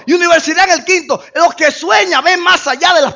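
loud, high preaching cry; preaching at full force. Acted. Preacher rousing the congregation, loud and high. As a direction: preach it out over the crowd.

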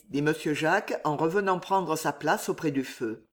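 plain reading voice

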